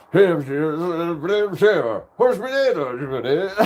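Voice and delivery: high, loud garbled Irish impression; mock Irish accent. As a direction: belt out a hammed-up Irish woman's greeting. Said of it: "you haven't heard this one".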